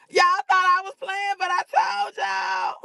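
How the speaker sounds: southern accent. Real, not acted. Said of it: "And my southern accent".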